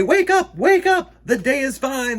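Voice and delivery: high, loud wake-up call. Acted. Waking someone up on a fine morning, loud high call. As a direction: sing out to rouse a sleeper. Words calling sleeper awake.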